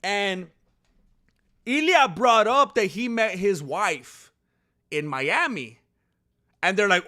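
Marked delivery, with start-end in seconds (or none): none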